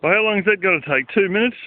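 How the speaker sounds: a hint of sarcastic disbelief; mocking the supervisor. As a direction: send up his naive estimate, quickly. Mocking new supervisor's estimate.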